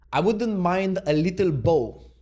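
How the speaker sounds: slow, loud, high quote-reading; grand declaration. Acted. Vlogger reading Trump's words on Japan, grand declaration.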